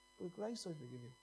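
softly, letting the line sink in; hushed repetition. Real, not acted. Preacher repeats God's forgiveness to the congregation, hushed. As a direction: say it quietly, almost to yourself.

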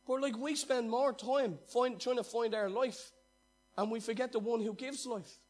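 fiery pulpit delivery; full-force preaching. Acted. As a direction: preach it hard to the crowd.

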